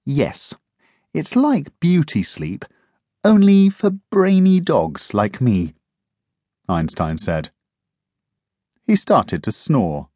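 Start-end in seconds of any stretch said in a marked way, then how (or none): 0.0-5.7 smug clever dog. Acted. Einstein smug explanation.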